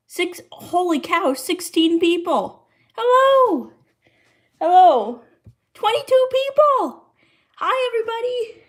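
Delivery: high, loud squeal of amazement; excited greeting. Real, not acted. Streamer amazed as viewers pour in, excited. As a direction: shout out a thrilled hello to a crowd. Viewer count jumps on livestream.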